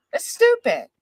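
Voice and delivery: sharp, high and loud scoff; exasperated outburst. Real, not acted. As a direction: blurt it out in disbelief. Repeated louder, higher.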